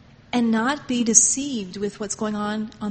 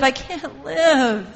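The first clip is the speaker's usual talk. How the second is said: anguished roar, repeated; 4 semitones higher, 7 dB louder, much slower than usual. Retold or imitated. God roaring from within his body, slow aching cry. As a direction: let the roar ring out again slowly. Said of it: "in his own body roaring".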